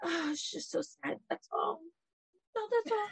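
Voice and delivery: mock Diana's passive aggressive sympathy; lightly voiced fake concern, pitched high and hushed; imitating Diana. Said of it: "and Diana's like".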